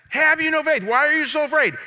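high, loud quoted rebuke; exasperated rebuke. Retold or imitated. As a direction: shout the questions as an incredulous challenge. Repeats Jesus' questions as rebuke.

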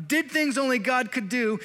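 ordinary delivery

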